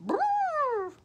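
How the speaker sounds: very high buzzing lip trumpet; imitated elephant trumpet. Copying the elephant for children, high trumpet.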